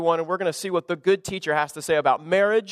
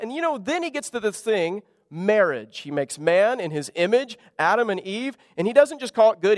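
ordinary talk

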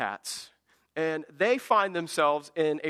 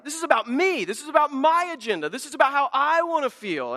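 selfish insistence; 8 semitones higher, 5 dB louder than usual. Retold or imitated. Self-absorbed cat voice.

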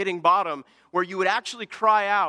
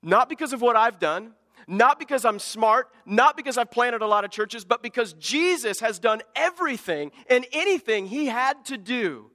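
building sermon cadence, louder; preaching; 4 semitones higher, 5 dB louder than usual. Acted. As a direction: preach it with rising force. Preaching with rising force.

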